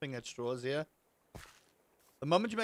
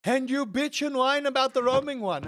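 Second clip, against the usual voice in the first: higher, louder mock scolding; exasperated complaint; 9 semitones higher, 7 dB louder than usual. Streamer arguing with fickle Freddy's fans, exasperated. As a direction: throw their fickleness back at them. Acted quarrel with fickle gamers.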